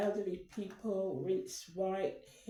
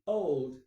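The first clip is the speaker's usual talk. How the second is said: put-on "old" hit hard and loud, falling; stress on old; 4 semitones lower, 6 dB louder than usual. Shown as a demonstration. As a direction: lean on "old" as the final word. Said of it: "we finish the sentence with".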